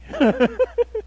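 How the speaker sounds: high burst of laughing; high laugh at the story's end; laughter. Real, not acted. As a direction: laugh at the punchline. Laughing at his own joke.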